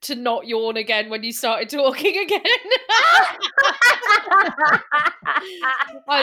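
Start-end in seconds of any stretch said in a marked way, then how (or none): 2.4-6.0 loud high laughter; big laugh. Real, not acted. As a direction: burst out laughing. Laughing after confession.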